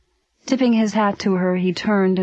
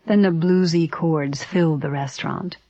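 plain reading voice

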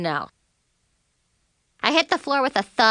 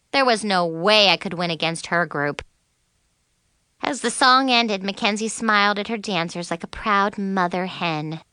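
ordinary delivery

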